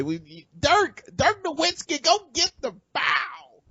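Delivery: high, slow, squeaky mock of the coach calling to Dirk; mock Avery Johnson. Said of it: "make fun of Avery Johnson and how he spoke".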